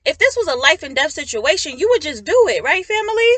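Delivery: loud, worked-up exhortation; frustrated. Real, not acted. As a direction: plead with them in real frustration. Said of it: "I'm getting a little frustrated".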